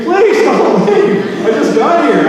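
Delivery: high, loud comic scream; screaming plea. Acted. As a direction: cry out in mock panic, begging them to stay. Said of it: "I was the one screaming".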